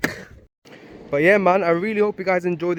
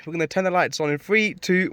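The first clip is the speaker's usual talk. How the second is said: normal talking voice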